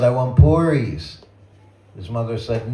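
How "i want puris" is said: put-on a boy asking for puris, pitched up and louder; retold child's demand, raised and loud; insistent child. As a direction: press the request like a little boy.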